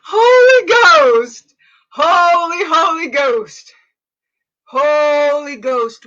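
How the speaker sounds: high, loud cry that settles down. Real, not acted. Pastor crying out to the Holy Ghost mid-prayer, loud and high, then easing.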